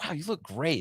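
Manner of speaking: a hint of a gushing doctor, high and soft. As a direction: lightly mimic the doctor's praise. Retold doctor compliment again.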